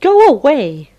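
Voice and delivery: big cat shout. Acted. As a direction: shoo the kitten off sharply. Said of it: "said a big cat".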